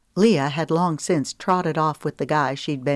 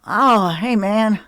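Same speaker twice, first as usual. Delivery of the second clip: sheepish greeting; 5 semitones higher, 6 dB louder, slower than usual. Acted. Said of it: "with a sheepish laugh".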